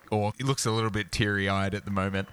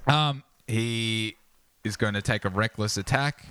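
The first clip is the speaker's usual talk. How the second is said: ordinary talk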